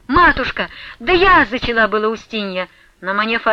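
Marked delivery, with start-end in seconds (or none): 0.1-1.4 high, louder plea cut short; urgent protest. A narrator's reading. Ustinya trying to justify herself to Manefa, urgent protest. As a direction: burst out in self-defence.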